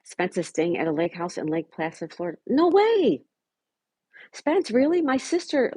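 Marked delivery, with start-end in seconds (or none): 2.5-5.7 high loud no way; surprised. Real, not acted. Streamer surprised a viewer is in her sister's town, high and loud. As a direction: burst out in disbelief.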